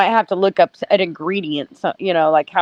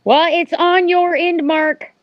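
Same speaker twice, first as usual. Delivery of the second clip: higher, slow, insistent; exasperated; 6 semitones higher, slower than usual. Acted. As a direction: drag it out impatiently. Insisting echo is Mark's.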